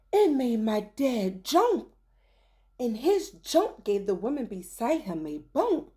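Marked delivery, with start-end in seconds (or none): none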